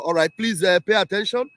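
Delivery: usual speaking voice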